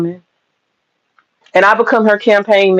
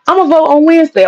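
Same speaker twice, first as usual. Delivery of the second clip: higher, quick voter's reply; imitated voter; 8 semitones higher, faster than usual. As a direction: chirp it like an eager voter.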